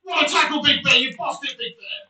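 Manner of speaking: loud, high shout of approval; excited praise. Real, not acted. Player hailing Big Bear's tackle, excited shout. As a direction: bellow your praise to the defender.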